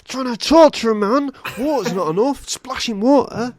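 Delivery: high, loud outraged complaint; exasperated rant. Acted. Torturer to helpers who brought only water, exasperated rant. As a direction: protest in comic outrage.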